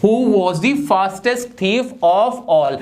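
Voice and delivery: sentence read out very slowly; slow teacher reading of the answer. Shown as a demonstration. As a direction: read it word by word for the class. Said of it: "superlative degree.".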